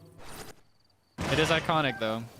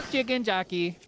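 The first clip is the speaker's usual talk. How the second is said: higher, louder meme callout; meme shout; 8 semitones higher, 5 dB louder than usual. Pretend. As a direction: blurt the meme out.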